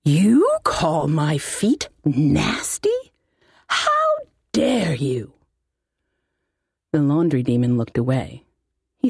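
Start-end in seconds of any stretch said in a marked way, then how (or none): 0.0-5.3 offended wizard. Acted. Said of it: "That happened when he got mad".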